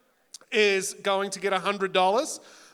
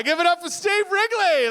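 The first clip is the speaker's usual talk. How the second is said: raised, loud call for applause; big announcer intro; 9 semitones higher, 5 dB louder than usual. Acted. Host introducing himself to the audience, big announcer shout. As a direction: announce the star act to the hall.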